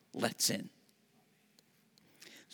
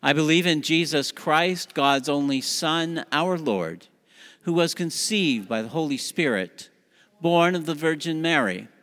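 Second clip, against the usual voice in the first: louder, higher unison recital; creed recitation; 5 semitones higher, 5 dB louder than usual. Acted. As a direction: lead the creed together.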